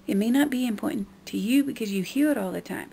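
ordinary delivery

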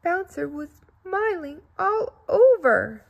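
glowing storybook delight. A narrator's reading. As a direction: beam the words out. Joyful climax of the scene.

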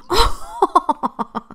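laughing. Real, not acted. Laughs at chaos in game.

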